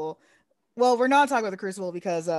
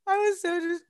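ordinary delivery

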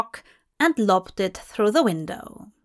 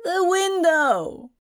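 put-on alarmed old man, high and drawn out; elderly man, afraid; 7 semitones higher, slower than usual. A narrator's reading. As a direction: cry out as a frightened old aristocrat. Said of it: "came the voice of an elderly man".

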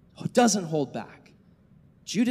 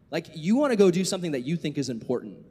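usual speaking voice

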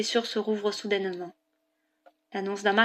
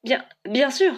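higher, louder, surprised stutter; pleased stammer; 4 semitones higher, 5 dB louder than usual. A narrator's reading. Boy answering in surprise and pleasure, stammering. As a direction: stammer it in happy surprise, voice lifting. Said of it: "bégaya-t-il de surprise et de plaisir".

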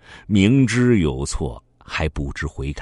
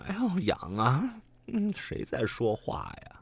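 drowsy and confused; 7 semitones higher, 11 dB quieter than usual. Acted. Thief waking confused from dream.

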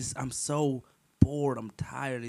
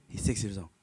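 usual speaking voice

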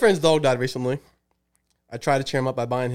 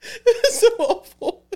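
high, squeaky words pushed out through laughing tears; crying with laughter; 12 semitones higher than usual. Real, not acted. After the dark joke, to Joe, cry-laughing and high. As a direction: laugh so hard it turns into crying. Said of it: "I'm crying".

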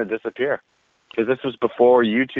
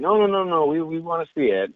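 retold higher-pitched protest; eager insistence; 7 semitones higher than usual. Insisting to the ticket teller they want in, eager protest. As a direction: brush off the warning, pitched up.

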